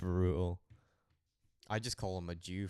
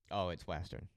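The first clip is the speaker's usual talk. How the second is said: ordinary talk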